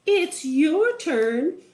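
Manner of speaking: high, slow, drawn-out wife; singsong wife handing over a chore; wizard's wife voice. A narrator's reading. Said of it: "she said".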